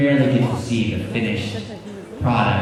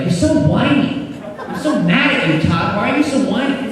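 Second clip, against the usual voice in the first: much higher mocking whine, half laughing; mock complaint; 10 semitones higher than usual. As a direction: tease him for whining. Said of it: "people on the other side of the building were like".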